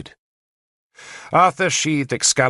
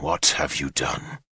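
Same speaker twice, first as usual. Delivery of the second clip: low, softer bitter question; 8 semitones lower, 6 dB quieter than usual. Acted. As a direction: let it drip with bitterness. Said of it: "in a bitter voice".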